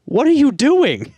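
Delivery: high-pitched mock mom telling kids off; scolding mom. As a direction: imitate an outraged mother, voice shooting up. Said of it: "she's like".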